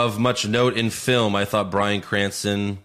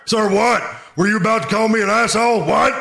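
barking drill instructor, high and loud; drill sergeant; 12 semitones higher, 6 dB louder than usual. Acted. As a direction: bark it like a furious sergeant. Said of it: "I'll be Stone Cold as Hartman".

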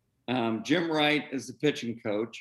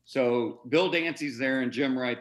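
normal talking voice

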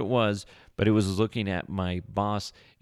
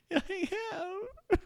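high, slow, laughing and breaking up; laughing squeak; 4 dB quieter, much slower than usual. Retold or imitated. Answering the boss, laughing helplessly. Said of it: "I'm like".

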